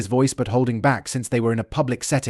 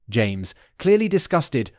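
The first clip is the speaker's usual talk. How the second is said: plain reading voice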